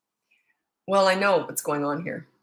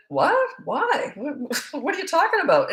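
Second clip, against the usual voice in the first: shocked disbelief; 8 semitones higher than usual. Retold or imitated. Mom shocked by son's remark.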